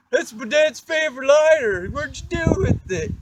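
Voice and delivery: put-on high, loud, whiny kid voice, slowed; whiny upset kid. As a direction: play the panicked friend, high and loud. Bobby's voice, upset.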